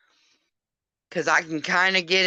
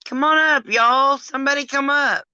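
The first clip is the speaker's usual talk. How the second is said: high, loud plea to come up; calling out; 8 semitones higher, 5 dB louder than usual. Real, not acted. Streamer begging viewers to join, loud call. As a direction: call everyone up, loud and bright.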